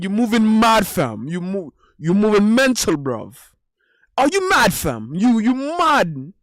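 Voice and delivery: loud, high, indignant British slang voice; hammed-up outraged British accent, swooping on "mad"; Shaquille's British accent. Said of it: "with his British accent".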